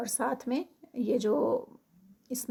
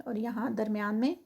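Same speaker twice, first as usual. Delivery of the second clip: normal talking voice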